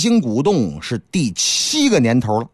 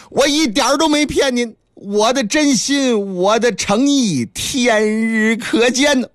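pleading monkey disciple; 5 semitones higher than usual. Acted. Wukong pleading sincerity to the master, higher monkey voice. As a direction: beg the old master to believe you.

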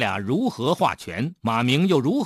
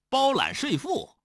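usual speaking voice

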